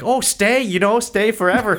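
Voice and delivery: high-pitched pleading invitation; hammed-up, sing-out welcome; mock over-welcoming host. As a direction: gush like an overeager host. Exaggerating the locals' welcome.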